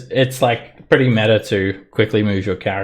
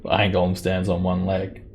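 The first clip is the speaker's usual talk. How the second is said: ordinary talk, nothing marked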